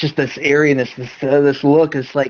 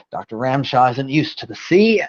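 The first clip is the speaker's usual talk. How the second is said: normal talking voice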